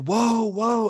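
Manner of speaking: loud, high, drawn-out holler; imitated yelling. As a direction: imitate a man yelling a warning. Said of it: "Why does he keep yelling".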